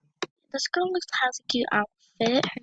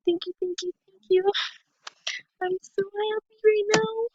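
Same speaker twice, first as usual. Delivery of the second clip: much higher, gushing thank-yous; overjoyed thanks; 11 semitones higher than usual. Real, not acted. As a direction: squeak out thanks in delight. Thanking the player for gift.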